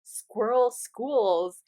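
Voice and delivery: very slow, savouring each word; dreamy slow repeat; drawn-out musing. Retold or imitated. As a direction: stretch it out like you're tasting it.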